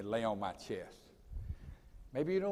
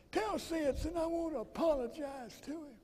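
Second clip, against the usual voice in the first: a much higher, softer imitation of a friend's voice; imitating Sterling; 12 semitones higher, 6 dB quieter than usual. Retelling the friend's phone calls, in a much higher, softer mimicking voice. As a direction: pitch way up and soft, mimicking the friend. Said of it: "It's the way he talked".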